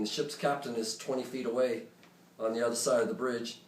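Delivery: usual speaking voice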